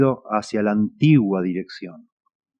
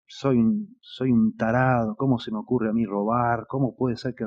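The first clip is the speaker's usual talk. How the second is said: quiet, broken self-reproach; hint of a sobbing prisoner in his cell; mock weeping thief; 7 dB quieter than usual. A jailed thief answering other prisoners, lightly voiced as crying. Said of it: "el tipo está llorando y le dicen".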